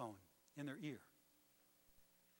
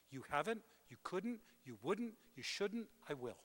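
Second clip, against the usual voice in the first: usual speaking voice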